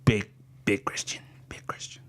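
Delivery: low conspiratorial whisper; whispered aside. Acted. Comic to the audience, whispered aside. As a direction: whisper the punchline to the crowd.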